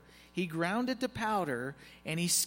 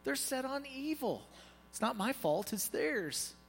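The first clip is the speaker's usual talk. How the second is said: higher, lightly pleading defence; excuse-making Aaron; 8 semitones higher than usual. Acted. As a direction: shift the blame onto others. Voices Aaron making excuses to Moses.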